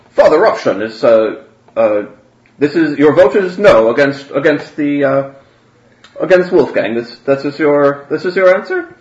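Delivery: incredulous questioning. Acted. Grand Theologian checking Father Russian's vote, halting and incredulous. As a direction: press him haltingly to confirm.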